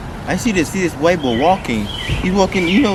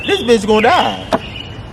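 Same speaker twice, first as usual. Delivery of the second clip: higher, slow, drawn-out disbelief; emphatic retold reaction; 6 semitones higher, slower than usual. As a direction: stretch it out, pitched up.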